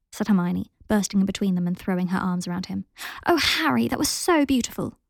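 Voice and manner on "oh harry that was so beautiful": gushing delight. A narrator's reading. Hermione gushing, hugging Harry.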